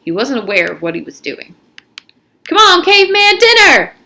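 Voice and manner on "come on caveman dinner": high, loud call across a room; loud, high shout to a friend. Acted. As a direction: call him to dinner from afar. Armpit calls across the room.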